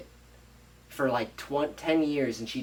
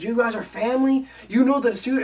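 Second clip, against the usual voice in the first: high-pitched, rising incredulous outburst; shocked discovery; 10 semitones higher, 5 dB louder than usual. Retold or imitated. Maid finding out they are one family, shocked. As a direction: blurt it out in scandalized disbelief.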